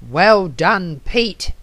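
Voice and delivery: ordinary delivery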